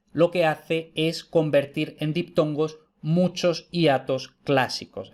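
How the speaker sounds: usual speaking voice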